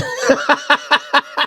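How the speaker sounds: high, breathless cackle; wheezing laugh. Real, not acted. Storyteller cracks up at a one-bullet shootout, wheezing high laugh. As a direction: burst out laughing, voice giving out.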